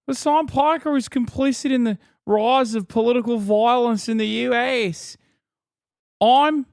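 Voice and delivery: exaggerated Australian broadcaster twang; mock Australian accent. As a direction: do a hammed-up Aussie news presenter. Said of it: "Is that you or her talking?".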